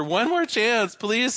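high-pitched mock plea; acted begging, pitched well up; pleading man. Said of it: "says the man".

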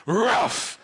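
playful woof shaped into a word; talking dog. Acted. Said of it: "says the dog".

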